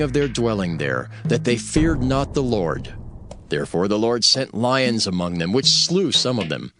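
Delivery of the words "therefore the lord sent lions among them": normal talking voice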